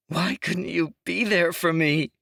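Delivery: high, loud, gasped out of breath; a voiced girl fighting for air, pleading; gasping for breath. A narrator's reading. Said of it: "Imogen gasped for breath".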